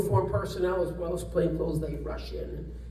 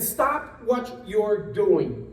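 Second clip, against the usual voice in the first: slow firm command; much slower than usual. Retold or imitated. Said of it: "I said".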